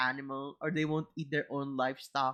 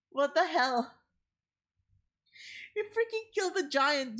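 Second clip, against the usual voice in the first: outraged disbelief; 11 semitones higher than usual. Real, not acted. Outraged at story's moral.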